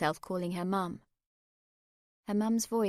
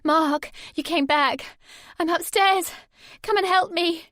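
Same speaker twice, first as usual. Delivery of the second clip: high, louder call, relieved; crying out; 9 semitones higher, 11 dB louder than usual. A narrator's reading. Sammy calling upstairs to Mark, relieved. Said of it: "she cried out".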